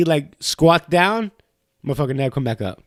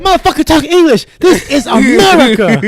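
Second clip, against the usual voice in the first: high, loud, fast mock rant; imitating customer, much higher and louder, laughing; angry customer; 11 semitones higher, 6 dB louder than usual. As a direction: yell at the kid like a cartoon patriot. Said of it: "He's like".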